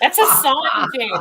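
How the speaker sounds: loud, high laugh over the words. Real, not acted. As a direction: burst out laughing while talking. Laughing at Jen's message.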